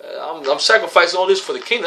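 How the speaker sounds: high, pleading complaint to the Lord; impatient believer. Retold or imitated. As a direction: act out an impatient brother begging. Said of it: "That happens to us sometimes".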